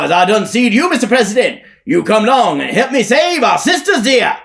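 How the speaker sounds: high, loud preacher hollering upward; shouting at top of voice. Acted. Elijah shouts to the President above. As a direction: call out to the heavens at full voice. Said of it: "shouts at the top of his voice".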